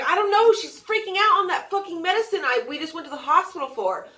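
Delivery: high, hurried, agitated retelling; acted-out flustered explanation to a parent; frantic explaining. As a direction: blurt it out fast in alarm. Frantic explanation to alarmed mother.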